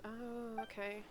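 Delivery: normal talking voice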